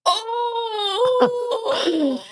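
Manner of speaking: high laughing oh of shock; a laughing yelp of horror; laughing mock shriek. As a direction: squeal it out through a laugh. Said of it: "I'm like".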